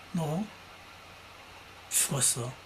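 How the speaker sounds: a soft, low mock threat through the teeth; quiet threat. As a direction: mutter it like a grudge. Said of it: "이를 갑니다".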